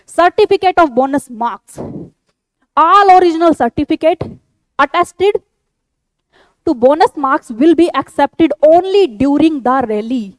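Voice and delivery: slow, emphatic read-out of a rule; reading aloud. Real, not acted. Teacher repeating the notification's rule to candidates, slow emphatic read-out. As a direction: spell out the rule slowly, phrase by phrase.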